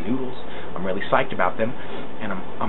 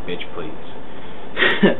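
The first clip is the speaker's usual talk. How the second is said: hushed, defiant aside; cheeky whispered comeback; sassy whisper; 3 semitones lower than usual. Retold or imitated. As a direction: whisper it once the cop is gone. Sassing the cop after he leaves.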